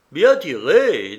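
slow, loud, swooping praise; drawn-out appreciative call; admiring exclamation. A narrator's reading. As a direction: savour the compliment to the gunner. Helmsman applauds the enemy's shot.

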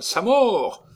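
high excited shout. Acted. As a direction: call it out loud. Painter shouting in the studio.

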